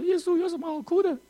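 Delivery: very high put-on squeaky protest; high mock-incredulous question; incredulous inner retort. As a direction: act out a high-pitched, puzzled complaint. Acts out his own inner retort.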